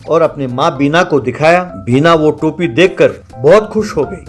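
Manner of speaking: ordinary delivery, nothing marked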